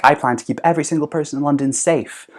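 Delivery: a hint of a politician's speech; politician promise. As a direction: reassure the crowd like a politician. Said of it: "he'll say something like".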